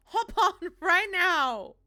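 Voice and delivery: shouting with a laugh; loud call. Real, not acted. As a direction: yell for him to hop on. Calling teammate to join game.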